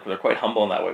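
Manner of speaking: ordinary delivery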